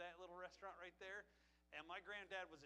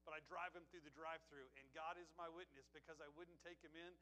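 ordinary delivery

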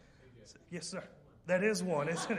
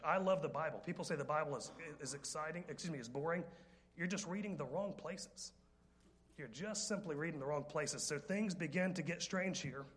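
normal talking voice

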